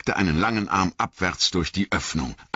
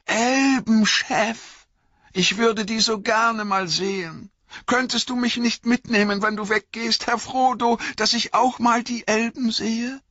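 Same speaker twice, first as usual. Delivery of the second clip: very high, yearning servant voice; wide-eyed, high-pitched longing; Sam, eager and pleading; 3 dB louder than usual. Acted. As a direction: beg excitedly to be taken along.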